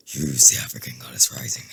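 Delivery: very low put-on voice; corrected imitation of him. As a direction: drop right down to imitate him. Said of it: "He don't sound like that".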